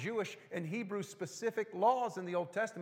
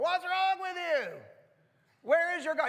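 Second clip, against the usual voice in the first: taunting Elijah; 11 semitones higher, 7 dB louder than usual. Retold or imitated. Elijah mocks the prophets of Baal, high loud taunt. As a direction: jeer at them like a prophet calling out a rival. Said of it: "He was talking smack to these people".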